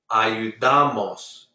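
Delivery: Ayudamos slowly, stressed on DA; second-to-last stress. Shown as a demonstration. Teacher repeating Ayudamos for the class, slow, stressed on DA. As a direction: draw out a-yu-DA-mos, leaning on DA in Ayudamos. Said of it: "stress on the second to last".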